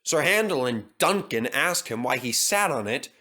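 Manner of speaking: usual speaking voice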